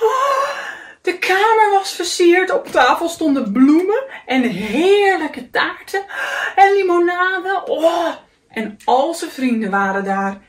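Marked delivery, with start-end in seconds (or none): 0.0-0.5 high, delighted "oh" of wonder; amazed gasp. A narrator's reading. Kikker sees his decorated room. 1.0-8.1 higher, excited storybook narration; wonderstruck. A narrator's reading. Marvelling at the surprise party room.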